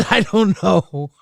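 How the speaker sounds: ordinary talk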